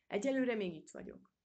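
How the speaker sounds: firmly insist, annoyed; angry retort. A narrator's reading. Rumini answering the grumbler back, mildly angry. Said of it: "vágott vissza Rumini dühösen".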